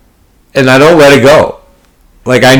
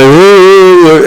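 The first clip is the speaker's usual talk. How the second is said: higher, louder hammed-up oohs; mock dramatic ooh; 7 semitones higher, 4 dB louder, slower than usual. Player mimics an opponent's dramatic look back, hammed-up oohs. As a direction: ham up a fake showboating reaction. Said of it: "Looking back at his cards, like".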